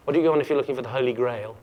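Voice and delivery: ordinary delivery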